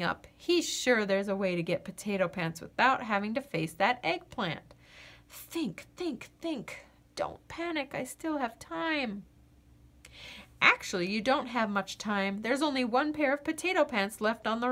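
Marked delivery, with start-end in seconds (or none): 5.3-9.2 quiet panicky self-talk; softly fretting to himself; anxious muttering. Acted. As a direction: hush yourself, panicking.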